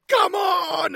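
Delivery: big movie yell. Retold or imitated. Two hosts recapping, yelling the hero's line. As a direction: bellow it like an action hero. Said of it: "he's yelling".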